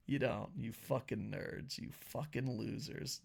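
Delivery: hushed mock taunt at the viewers; mock insult. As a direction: murmur the insults, drawn out.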